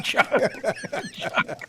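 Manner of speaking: word broken up by laughter; laughing. Real, not acted. Co-hosts cracking up over a cow pun name, laughing. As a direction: say it while cracking up.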